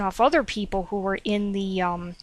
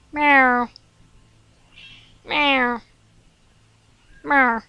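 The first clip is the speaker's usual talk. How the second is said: higher, louder playful cat meows; meowing at the cat; 4 semitones higher, 4 dB louder, much slower than usual. Pretend. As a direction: meow at the cat to call him. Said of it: "meowing into the microphone right now like a doofus".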